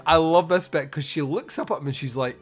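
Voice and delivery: ordinary talk, nothing marked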